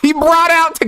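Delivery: high-pitched, hysterical disbelief; real incredulous cry, pitched very high; incredulous outburst. Real, not acted. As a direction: exclaim it way up high, cracking up in disbelief. Cracking up at the clip.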